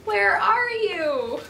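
a lost dog crying into the dark; plaintive cry. Acted. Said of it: "his plaintive cry".

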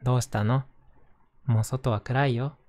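gentle storybook narration voice; reading to children. Acted. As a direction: read it aloud to little kids. Reads a picture book aloud.

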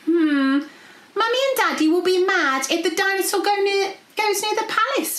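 high, worried princess voice; thinking aloud. A narrator's reading. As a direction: fret aloud as a little princess. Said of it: "Princess P thought aloud".

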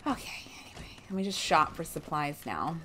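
breathy whispered aside. Real, not acted. Player muttering to herself while shopping, whispered aside. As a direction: drop to a whisper, moving on. Aside after ranting at NPC.

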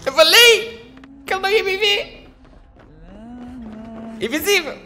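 high, triumphant taunting shouts; gloating excitement. Real, not acted. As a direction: crow over the win. Gloating after escaping.

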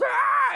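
high loud mock squeal; pig squeal. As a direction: shriek like a pig. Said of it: "the pig goes".

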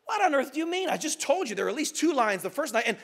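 high-pitched opening, mildly indignant; lightly acted disbelieving boy; incredulous protest. A narrator's reading. Boy arguing with the voice about lions, incredulous.

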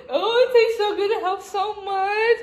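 laughing relief. Real, not acted. Eating ice cream, relief.

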